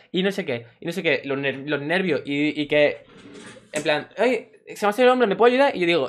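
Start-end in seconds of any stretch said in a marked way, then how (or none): 4.2-5.8 higher, fast, mock-whining kid; whiny hurt boy. Boy pleading to the lifeguard, mock whiny and high.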